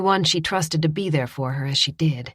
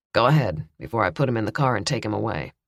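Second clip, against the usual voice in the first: lower, brisk officer voice; gruff officer; 6 semitones lower than usual. A narrator's reading. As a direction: hurry it like a busy cop. Officer answers Andy.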